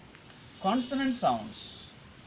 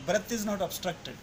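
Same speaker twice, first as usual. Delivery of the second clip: ordinary talk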